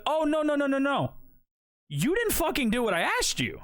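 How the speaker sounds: high-pitched, rapid-fire objection, re-enacted; indignant refusal. Customer refusing to pay the barber, acted indignation. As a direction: protest with rising indignation.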